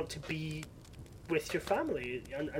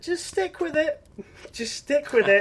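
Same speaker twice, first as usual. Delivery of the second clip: coaxing; 10 semitones higher, 8 dB louder than usual. Retold or imitated. Retold coaxing girlfriend.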